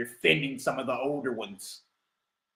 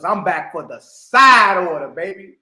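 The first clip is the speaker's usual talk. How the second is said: hype call; 11 dB louder than usual. Real, not acted. Hyped self-announcement.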